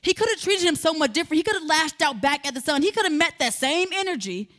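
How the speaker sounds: usual speaking voice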